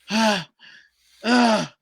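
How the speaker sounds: imitated short-of-breath panting, a bit high; panicked gasping. Groom mimicking his panicking best friend, gasping short of breath. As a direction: act out gasps of someone freaking out. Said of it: "shortness of breath".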